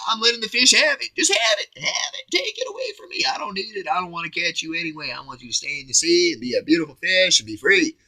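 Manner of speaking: very high, sweet voice to a fish. Pretend. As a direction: coo to the fish, letting it go. Talks to an imaginary fish.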